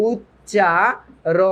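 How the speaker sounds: উচ্চারণের very slow, "uch-cha-ro-ner"; syllable by syllable. Shown as a demonstration. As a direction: pull উচ্চারণের apart syllable by syllable. Splitting a word into syllables. (ভেঙে ভেঙে উচ্চারণ)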